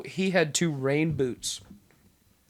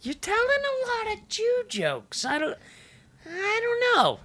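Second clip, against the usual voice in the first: mock reproach. Jokingly new convert objecting to jokes, mock reproach.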